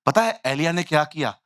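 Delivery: ordinary delivery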